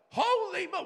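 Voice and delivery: a sudden high gasp of amazement, cut off; astonished exclamation. Retold or imitated. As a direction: caught off guard, blurting it out. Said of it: "we start freaking out".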